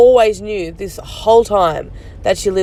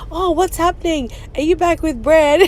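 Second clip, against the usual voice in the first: mock innocent question; 8 semitones higher than usual. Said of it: "join and be like".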